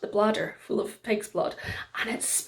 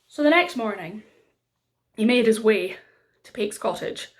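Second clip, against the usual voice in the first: ordinary delivery, nothing marked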